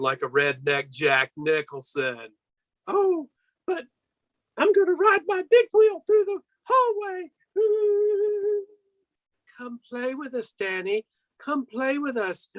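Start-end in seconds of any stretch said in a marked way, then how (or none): 2.9-8.6 put-on high excited child voice. Plays little Danny in Shining. 9.6-12.5 put-on high, soft ghostly girls' voice; high quiet eerie twin girls calling Danny; creepy twins. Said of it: "the Shining twins' creepy call".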